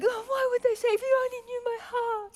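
mock wail. Preacher mocking her own hurt, whiny and high. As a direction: overact the wounded heart. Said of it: "I'm like".

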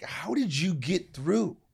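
high, drawn-out question; earnest asking. Retold or imitated. Grandchild asks grandfather about his struggles, earnest asking. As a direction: ask slowly with the pitch swooping high.

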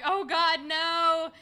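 loud, high horrified wail; mock horror. Retelling their horror at dead fish, wail. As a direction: cry out in dismay.

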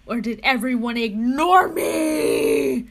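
a loud, hammed-up crying wail; theatrical sob-shout of despair; mock wail. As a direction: pretend to be heartbroken at being ignored.

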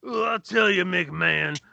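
lightly voiced Macho Man growl; a hint of Macho Man promo; Macho Man impression. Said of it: "do it like the old commentary".

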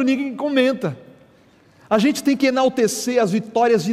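high, loud, driving sermon delivery; fired-up pulpit exhortation; full-force preaching. Acted. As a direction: preach it out and rally the church.

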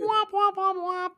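very high imitated womp-womps; mock credits sting. Host mocking the canceled podcast, high womp-womp sting. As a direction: squeal out a mock outro horn. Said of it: "roll the credits".

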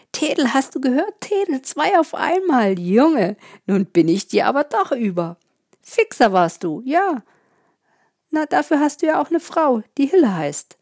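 high, loud, jubilant boasting; overjoyed. A narrator's reading. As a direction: crow with joy to a friend. New father boasting to friend.

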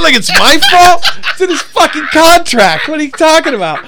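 very high, loud comic protest; indignant outburst. Retold or imitated. Opener blamed for the pictures at the show, indignant shouting. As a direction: yell it high in exasperated self-defense.

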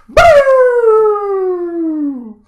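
mock yell boo. Kicking like Anchorman dog.

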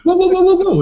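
high, loud string of panicked whoas; retold alarmed shout. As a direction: yelp it out to halt someone mid-swing. Retold alarm at doctor with hammer.

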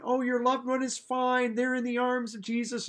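high, put-on platitude voice; mock consoling. Preacher mimicking people comforting the bereaved, mock-soothing.

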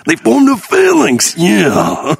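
slow, high-pitched wrestler impression; Macho Man impression. Recalling a comic's bit, hammed-up wrestler voice. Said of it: "Macho Man Dan Soda doing their thing".

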